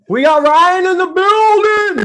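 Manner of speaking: high, loud, stretched-out guest announcement; hype shout. Real, not acted. Host announces Ryan joining the podcast, hype shout. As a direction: holler a big slow welcome.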